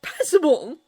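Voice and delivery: loud, incredulous "Passable?"; offended disbelief. A narrator's reading. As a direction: throw the word back, stung. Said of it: "Hiccup furrowed his brows".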